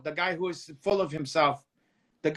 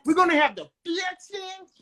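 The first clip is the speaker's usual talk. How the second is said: high-pitched mock impression; imitating his fixings line; 9 semitones higher than usual. Retelling Stevie's line over the co-host, high impersonation. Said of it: "And then he's like".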